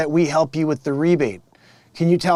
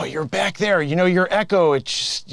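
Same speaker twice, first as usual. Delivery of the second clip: ordinary delivery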